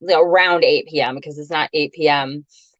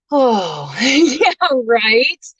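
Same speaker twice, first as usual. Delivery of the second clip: laughing; 7 semitones higher, much slower than usual. Real, not acted. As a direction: laugh it out, slightly embarrassed. Laughs at her own mess.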